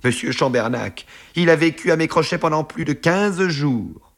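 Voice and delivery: high, aggrieved complaint; indignant protest. A narrator's reading. Friend complaining to his boss of being sponged on, high and indignant.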